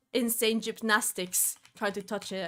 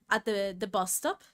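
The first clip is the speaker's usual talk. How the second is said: ordinary talk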